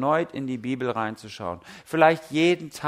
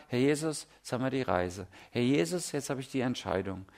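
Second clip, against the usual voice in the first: hushed, softer prayer to Jesus; quiet prayer; 4 semitones lower, 8 dB quieter than usual. Retold or imitated. Preacher voicing a quiet everyday prayer, hushed. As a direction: pause and pray softly, inward. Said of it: "innehalten und sagen".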